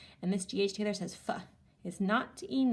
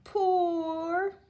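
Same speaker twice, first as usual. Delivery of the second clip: excited; 9 semitones higher, 5 dB louder than usual. Shown as a demonstration. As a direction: crack called out high. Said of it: "now let's do our action words".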